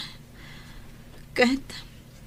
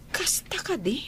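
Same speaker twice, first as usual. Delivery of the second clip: whisper; 7 dB quieter than usual. Acted. Shocked hushed gossip question.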